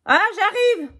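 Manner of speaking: high, loud call to another room. Real, not acted. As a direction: shout down the stairs to the door. Répond à quelqu'un à la porte. (appel depuis une autre pièce, vers la porte)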